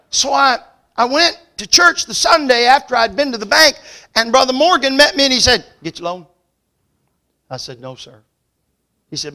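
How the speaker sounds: loud, high preacher's delivery; preaching at full force. Acted. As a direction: drive the story home from the pulpit.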